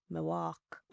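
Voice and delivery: small, soft attempt at Jamaican; hesitant, hushed mock Jamaican; bad Jamaican accent. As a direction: timidly fake a Jamaican accent. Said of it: "I cannot do a Jamaican accent".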